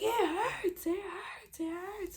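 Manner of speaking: very high, strained mock wail; retold pained yelling. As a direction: wail it in imitated labor pain, fading. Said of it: "So I'm screaming, I'm yelling".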